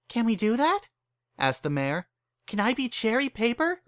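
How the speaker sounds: delighted at the idea; eager young mare. Acted.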